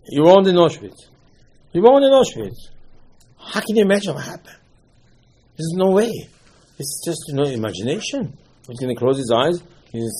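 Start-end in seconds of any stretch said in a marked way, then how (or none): 0.1-6.1 high-pitched, emphatic disbelief; incredulous objection. Acted. Rebutting someone who says he imagines Auschwitz, incredulous. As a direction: push back hard, pitch raised.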